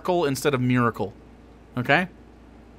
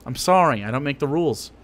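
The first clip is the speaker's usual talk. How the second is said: usual speaking voice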